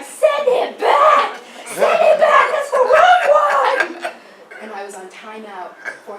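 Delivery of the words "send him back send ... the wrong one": much higher, very loud, slow wailing shout with laughter; laughing while acting out a child's screaming fit; screaming tantrum. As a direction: howl it like a furious five-year-old. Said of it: "I'm screaming, I'm crying".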